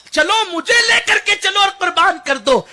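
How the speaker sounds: high, loud plea; impassioned shout. Acted. The little boy begging his mother to offer him, shouted. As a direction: shout the child's plea with fervour. Child begs mother, preacher's climax.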